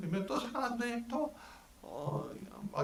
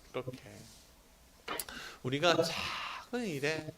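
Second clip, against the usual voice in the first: usual speaking voice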